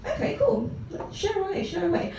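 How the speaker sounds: high, soft, breezy go-ahead; polite feigned enthusiasm. Acted. Woman humouring her writer date, polite feigned enthusiasm. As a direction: humour them in a small high voice.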